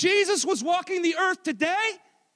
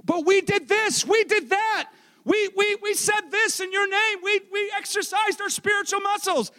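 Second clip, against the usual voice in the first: high, indignant mock pleading; protesting plea; 6 semitones higher than usual. Rejected followers pleading with Jesus, high protesting voice. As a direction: argue your case in a raised, insistent voice.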